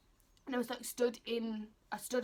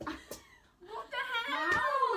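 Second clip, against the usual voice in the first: very slow, high, stretched-out disbelief; incredulous; 5 dB louder, much slower than usual. Retold or imitated. Thinking she looks like a horse on an invisible bike, stretched high disbelief. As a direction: drag it out high in shock. Reacting to own horse-like riding.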